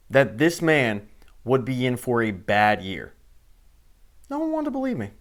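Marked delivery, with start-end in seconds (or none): 4.3-5.1 mock whiny voice.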